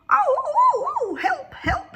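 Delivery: very high loud frightened cries; cry for help. Acted. Dog fallen through the cave cries out, frightened. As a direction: call for help from the hole.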